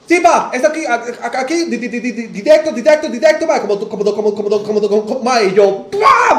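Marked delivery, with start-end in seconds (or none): 0.1-5.5 high, loud exaggerated stammer with botched R; imitated stutterer. Stranger on the corner giving directions, mocked loud high stutter. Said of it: "hablaba tartamudo y hablaba con esta vara". 5.9-6.4 very high, loud mock guffaw noise; imitated sputter of bursting out laughing; explosive laugh burst. Comedian laughing in the stranger's face, re-enacted loud high burst. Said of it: "me le reí en la cara".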